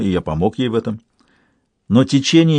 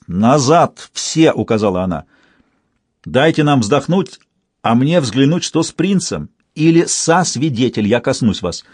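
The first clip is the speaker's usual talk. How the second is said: usual speaking voice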